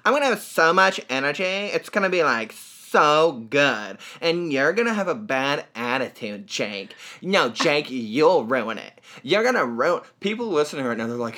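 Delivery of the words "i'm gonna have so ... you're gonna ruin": hammed-up, loud imitation of co-host; mock her with stretched words in a put-on voice; Chia impression. Host mimicking co-host scolding him before podcast, mocking impression. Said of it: "that's a spot-on impression".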